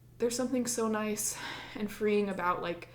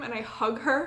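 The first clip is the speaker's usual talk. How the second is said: ordinary delivery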